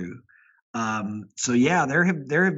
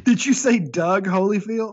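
amused disbelief; 6 semitones higher, 5 dB louder than usual. Real, not acted.

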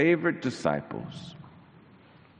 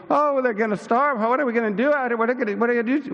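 high, anxious mock fretting; fretting disciples; 10 semitones higher, 3 dB louder, much faster than usual. Preacher voices disciples worrying the crowd will starve. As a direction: ham up the worried disciples.

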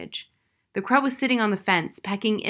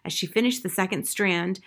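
plain reading voice

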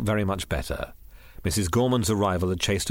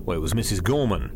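ordinary delivery

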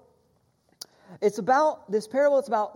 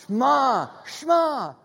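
a firm order, louder and higher; commanding Shema; 6 semitones higher, 7 dB louder, slower than usual. Shown as a demonstration. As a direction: bark "Shema" at them like a command. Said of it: "say it like you're telling somebody to Shema".

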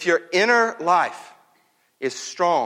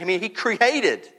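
ordinary delivery

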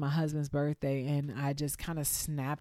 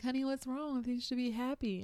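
a touch of a kindly nurse, pitched higher; lightly voiced nurse coaxing a tearful patient; soothing nurse; 9 semitones higher than usual. Nurse comforting her in hospital.

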